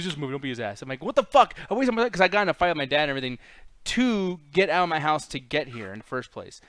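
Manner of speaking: normal talking voice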